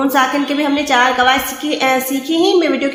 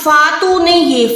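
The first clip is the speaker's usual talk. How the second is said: usual speaking voice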